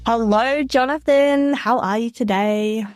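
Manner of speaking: normal talking voice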